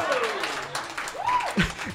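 shrill burst of laughter; high squealing laugh. Real, not acted. Narrator cracking up over the knockout, high squealing laugh. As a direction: let a high laugh break out. Laughing at his own story.

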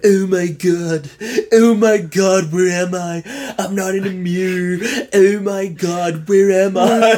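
Nick Cage impression. Friend mimics Nick Cage in the movie, bewildered put-on accent. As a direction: draw it out high like a bewildered movie vampire. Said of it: "his impression of somebody doing a Transylvanian accent".

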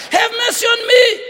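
high, loud shouted plea; crying out. Acted. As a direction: shout for mercy from torment. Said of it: "He cries out".

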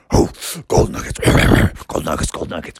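low breathy wolf chant; lightly voiced wolves. Wolves coming out of the forest chanting. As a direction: growl it like hungry wolves.

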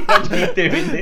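squeaky laughing mock threat; laughing high voice. Laughing high voiced corpse man.

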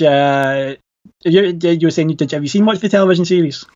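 usual speaking voice

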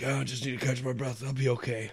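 hushed, quick imitation; mock winded fighter. Imitating a fighter who needs to catch his breath, hushed. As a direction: say it very softly, playing winded.

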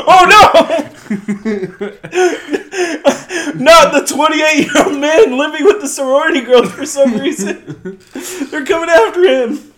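high, loud, laughing mock alarm; laughing mock panic. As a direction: shriek it through laughter, hammed-up.

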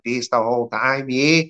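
mocking Kiwi accent, rising; exaggerated Kiwi twang; New Zealand accent. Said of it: "dickheads talking like".

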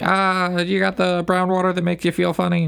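high, dopey clueless customer; high goofy voice asking for whiskey; goofy put-on voice. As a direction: play dumb in a high silly voice. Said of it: "And I'm like".

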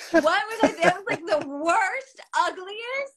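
high, loud laughing squeal; laughing cringe. Real, not acted. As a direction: squeal it half-laughing, half-crying in embarrassment. Cringing at old photos.

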